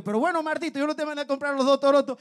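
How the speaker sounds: high, loud mock argument; mock scolding. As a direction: scold him for the wrong groceries. Friend scolding gambler.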